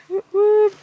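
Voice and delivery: high whooping cheer. Real, not acted. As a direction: whoop for a friend. Cheering friend's tent.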